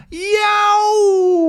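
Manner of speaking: very high, loud yelp; pain shout. Acted. Thompson bitten by the crab, a loud high yelp. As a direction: shriek it out in pain. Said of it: "the Thompson goes".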